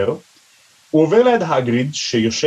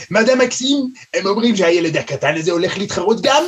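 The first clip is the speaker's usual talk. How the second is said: lightly put-on French accent, higher; a haughty French girl, lightly done; 6 semitones higher than usual. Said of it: "במבטא צרפתי כמה".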